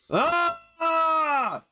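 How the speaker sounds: high, drawn-out visceral scream; guttural battle yell. Acted. Game master voicing an axe-swinging foe's charge, guttural yell. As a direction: let out a long, high-pitched war cry. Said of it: "screams just a guttural, visceral yell".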